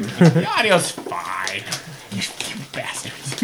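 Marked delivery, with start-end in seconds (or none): 2.1-3.4 unvoiced mock curse through teeth; breathy hiss through teeth. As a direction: hiss it out softly, teasing. Mock anger at friends' picks.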